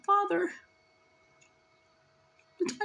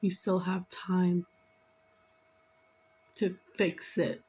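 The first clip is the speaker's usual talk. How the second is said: slow, low plea from a father; lightly voiced father, slowed right down; channeled father; 7 semitones lower, slower than usual. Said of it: "He's saying".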